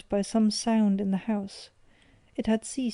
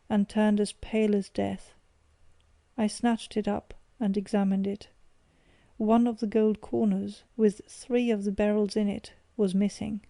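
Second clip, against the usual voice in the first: plain reading voice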